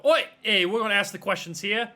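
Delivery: loud, high, commanding outburst; bossy shout. Acted. Player's character takes over questioning a captive, bossy shout. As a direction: cut in loudly, taking charge.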